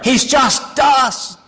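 slow, loud, drawn-out proclamation; shouted punchline. Acted. Preacher to congregation about the proud footballer, slow shout. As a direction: nail the point, word by word.

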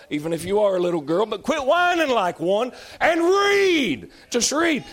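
full-force preaching. Real, not acted. Preacher scolding whiny Bible readers, high and loud. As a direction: preach it hard, as if hammering the point home.

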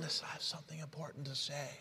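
barely above a breath, gentle; still small voice. Acted. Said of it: "still small voice".

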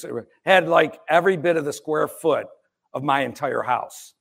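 normal talking voice

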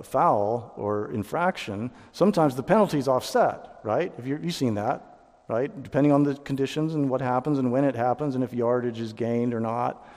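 ordinary delivery

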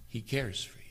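soft, overcome with emotion; softly, barely holding it together; choked up quietly. Real, not acted. Preacher overwhelmed telling his flock: quiet and moved. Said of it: "I can't say this and do it justice".

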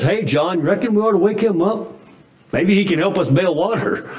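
a fisherman nudging his friend, a bit anxious; Peter to John. Retold or imitated.

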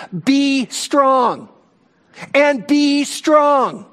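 a preacher pounding the double imperative; emphatic command. Shown as a demonstration. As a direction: drive home both commands like an order. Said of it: "This is a command".

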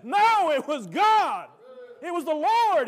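slow, loud shout of "No, it was God"; preaching shout. Retold or imitated. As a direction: proclaim it like a testimony. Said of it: "He said".

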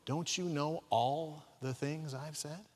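soft, slow, disbelieving; exasperated Jesus. Acted. Jesus to the disciples, softly and slowly incredulous. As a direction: let the disbelief sink in slowly. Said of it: "Jesus is now looking at them going".

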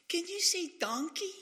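put-on very high girlish falsetto; girlish request. Starbucks girls begging the Scot to say a word, high girlish falsetto. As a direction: put on a squeaky pleading girl's voice.

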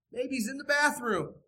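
ordinary talk

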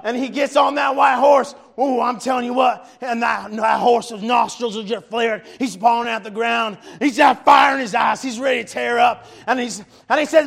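loud, fervent revival preaching; impassioned sermon delivery; preaching at full force. Real, not acted. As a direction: preach it to the rafters, building to shouts. Preacher reading and preaching Revelation.

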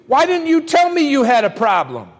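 ordinary talk, nothing marked

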